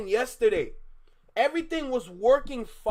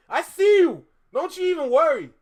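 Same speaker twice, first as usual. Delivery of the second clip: hyped; 6 semitones higher, 7 dB louder, faster than usual. Real, not acted. Teasing chat, suddenly energized.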